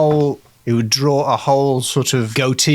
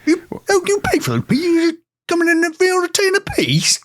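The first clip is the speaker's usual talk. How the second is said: much higher indignant West Country farmer; yokel farmer accent. Acted. Farmer confronting the king over stolen peas, indignant yokel accent. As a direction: scold the pea thief in a broad rural accent. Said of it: "farmers with quite unusual accents".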